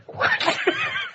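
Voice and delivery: very high shriek of laughter; real squeal of laughter. Real, not acted. Laughing fit continues.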